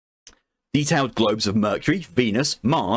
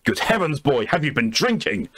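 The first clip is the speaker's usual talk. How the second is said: put-on higher-pitched, affronted old gentleman; scandalised Blackwood; 5 semitones higher than usual. Blackwood, told he is a sea slug, scandalised and higher. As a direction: sound shocked at such an insult. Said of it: "I'm gonna have to do a voice for it".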